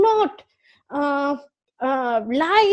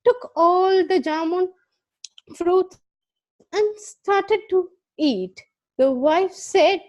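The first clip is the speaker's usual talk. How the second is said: usual speaking voice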